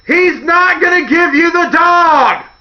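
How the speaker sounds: high, loud shouted warning, slow; screaming at the TV that it's a trap. Pretend. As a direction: yell it at the TV. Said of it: "You're screaming".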